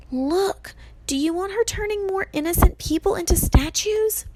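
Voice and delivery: high, pressing question; urgent challenge. A narrator's reading. Annabeth pushes Percy to act, urgent and high. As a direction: insist with pleading urgency.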